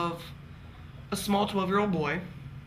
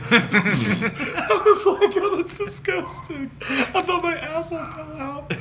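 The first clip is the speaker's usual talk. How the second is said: laughing; 10 semitones higher, 4 dB louder, slower than usual. Real, not acted.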